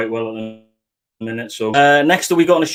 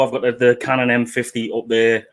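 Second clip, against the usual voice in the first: normal talking voice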